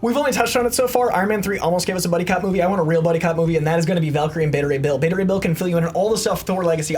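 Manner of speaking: ordinary talk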